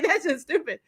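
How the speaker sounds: high, laughing through the words; laughing disbelief. Retold or imitated. Co-host reacting to an absurd comic plot, laughing disbelief. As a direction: crack up while dismissing it.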